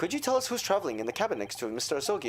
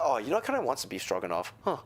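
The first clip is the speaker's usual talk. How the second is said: normal talking voice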